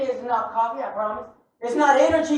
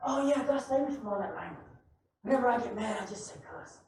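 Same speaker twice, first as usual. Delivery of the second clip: quiet, lower, offhand reply; soft, lower, casual coworker; 6 semitones lower, 14 dB quieter, faster than usual. Retold or imitated. Coworker explaining his habit, quiet and low. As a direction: shrug it off softly. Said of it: "He goes".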